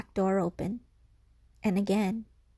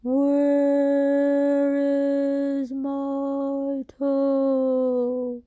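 slow, drawn-out ghostly moan, higher and louder; ghostly groan; 6 semitones higher, 7 dB louder, much slower than usual. Acted. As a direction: stretch every word like a ghost calling from the dark. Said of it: "the voice groaned".

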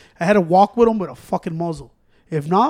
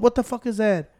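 usual speaking voice

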